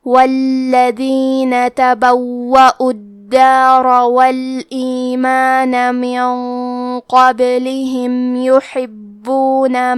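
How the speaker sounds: slow, melodic tajweed recitation; measured Quranic chant. Acted. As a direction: chant the verses slowly and evenly.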